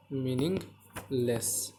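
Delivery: very slow, word split in two; drawn out. Real, not acted. Teacher writing out the answer for pupils, slowly drawn out. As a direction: stretch meaningless out slowly, syllable by syllable.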